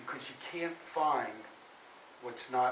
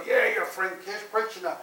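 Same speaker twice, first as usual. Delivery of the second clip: taunting, higher and louder; mocking kids; 7 semitones higher, 6 dB louder than usual. Classmates tease boy about his friend, mocking. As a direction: jeer like children teasing a classmate. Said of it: "they start to mock him".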